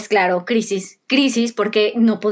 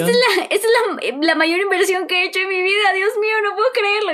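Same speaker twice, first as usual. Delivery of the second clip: excited disbelief; 9 semitones higher than usual. Retold or imitated. Retelling her joy at getting the car.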